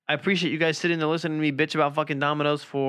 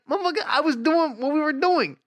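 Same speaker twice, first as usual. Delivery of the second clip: much higher, louder hammed-up indignation; mock outrage; 12 semitones higher, 3 dB louder than usual. Boyfriend replays his own angry reply, high hammed-up outrage. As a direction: squeal the complaint back at her. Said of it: "I was like".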